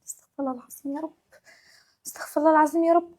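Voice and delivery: soft, muttered plea to God under her breath; quiet muttered exasperation; exasperated prayer. Acted. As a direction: sigh it to yourself, worn out. Exasperated sigh to herself.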